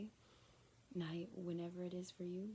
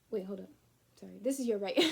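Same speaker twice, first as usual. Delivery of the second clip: louder, building to a near shout; mock correction; 10 dB louder, faster than usual. Voicing the friend realizing the mix-up, louder. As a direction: stop them short, then over-apologize loudly.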